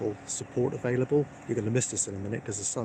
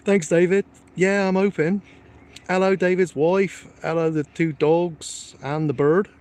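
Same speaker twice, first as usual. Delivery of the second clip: excited greetings; 7 semitones higher, 8 dB louder, slower than usual. Real, not acted. Greeting viewers in chat.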